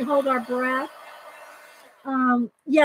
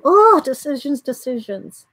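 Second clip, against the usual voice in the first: mock-dramatic dithering; 3 semitones higher, 4 dB louder than usual. Real, not acted. Torn over art choice.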